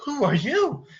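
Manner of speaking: startled question. Acted. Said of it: "Belle turns around to see".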